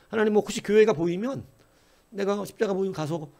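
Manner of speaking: preacher voicing a proud star bargaining with God, coy; haughty and coy. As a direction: play hard to get, aloof and teasing. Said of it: "튕기듯이 뭐 이렇게 도도하게".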